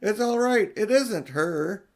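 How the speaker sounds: high, loud calls out to the cave; excited shouting. Acted. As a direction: shout them out, bursting with relief. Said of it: "he shouted".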